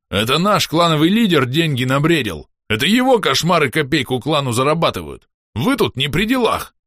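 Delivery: high, heated protest; indignant yelling. A narrator's reading. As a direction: defend the clan's money from intruders. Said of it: "завопил Бом". (возмущённо, на повышенных тонах)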